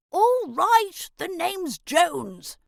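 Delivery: high, slow gruff little grub. A narrator's reading. Jones the grub grudgingly gives his name to Elray, gruff. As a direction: grumble it like a grumpy old grub. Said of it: "said the grub gruffly".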